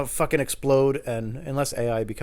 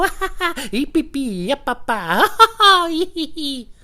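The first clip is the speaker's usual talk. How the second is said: high staccato beeps and fake laughs; mocking joke-chatter noises; 13 semitones higher, 8 dB louder than usual. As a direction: rattle off silly syllables in triplets, leaping high. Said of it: "a pattern of the way that they speak".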